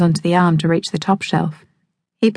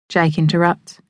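ordinary delivery, nothing marked

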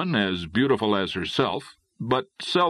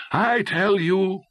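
high, slow, drawn-out insistence; eager insistence; 9 semitones higher, 3 dB louder, slower than usual. A narrator's reading. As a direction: press the point with enthusiasm. Connor eager for new adventure.

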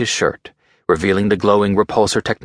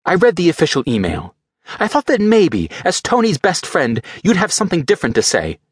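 high, hurt and reproachful woman's voice; Pepper, disappointed in Tony's friend; Pepper, bitter; 10 semitones higher than usual. Acted. Pepper reproaches Rhodey as Tony's best friend, high and bitter.

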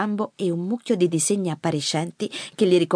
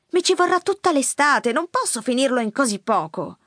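high-pitched objection, easing down; protesting granddaughter; 7 semitones higher than usual. A narrator's reading. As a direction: object that it can't be done. (nipote che protesta, voce acuta)

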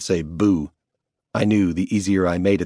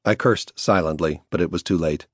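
plain reading voice

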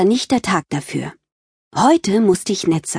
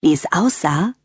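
ordinary talk

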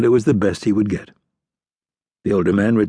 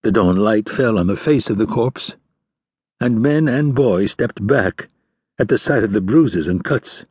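ordinary delivery

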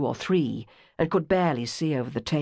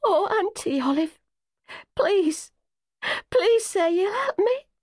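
pleading sobs. A narrator's reading. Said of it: "she burst into desolate sobs".

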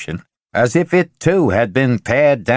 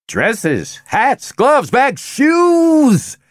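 voice rising; much slower than usual. Acted. Benjamin lists what excites women, rising to a shout. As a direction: climb with each item to a near shout. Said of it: "his voice rising".

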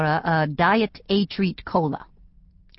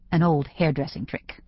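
ordinary delivery

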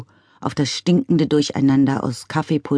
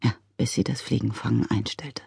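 lower, softer gloomy repeat of "Ja, bis sie das Fliegenfangen einstellte"; 3 semitones lower, 8 dB quieter than usual. A narrator's reading. Father gloomily repeating his daughter's words, low and soft. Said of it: "wiederholte Mister McCrumpet düster".

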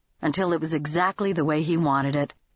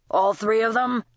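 put-on lightly rasped question from an old man; old Red presses Lady Jane, lightly rasping; 4 semitones higher than usual. A narrator's reading. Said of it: "he rasped".

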